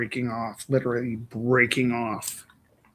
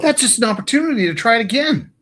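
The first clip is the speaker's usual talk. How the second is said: wide eyed naive; 9 semitones higher, 6 dB louder, faster than usual. Pretend. Mock upbeat encouragement.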